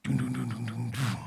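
softly voiced mock engine rumble; truck rumble imitation. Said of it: "kind of truck that you hear from, like, miles away".

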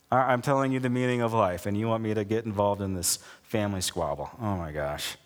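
fast, sinking into resigned disbelief; acted exasperation, ending low and soft; weary exasperation. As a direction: let the voice drop away as you give up on them. Acted exasperated Jesus, giving up.

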